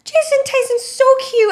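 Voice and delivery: very high, loud squeal; squealing drunk mom. Retold or imitated. Drunk mom fawning over Jason in the kitchen, very high squeal. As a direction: shriek it like a smitten tipsy mom.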